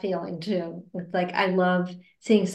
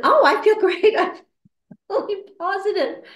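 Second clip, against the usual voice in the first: bubbly, high and louder, laughing; cheerful; 11 semitones higher, 6 dB louder than usual. Retold or imitated. Telling surgery staff she feels great, bubbly laugh. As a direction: beam with hypnotised positivity.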